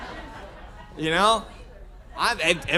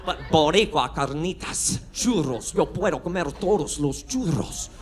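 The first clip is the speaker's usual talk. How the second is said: put-on gringo Spanish accent; 3 semitones lower than usual. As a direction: show off gringo Spanish, rolling the r's.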